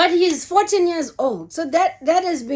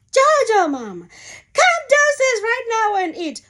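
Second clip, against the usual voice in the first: high, bossy motherly call; aunties calling; 10 semitones higher, 3 dB louder than usual. Retold or imitated. Aunties phoning up to order the mother downstairs, high and bossy. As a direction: order her down to eat, fond and firm. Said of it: "they would call up and say".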